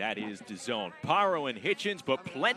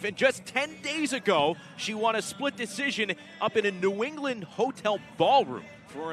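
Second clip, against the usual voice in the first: higher and louder over the music; excited commentary; 6 semitones higher, 3 dB louder than usual. Real, not acted. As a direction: raise the energy for the ring walk. Commentator over ring walk music.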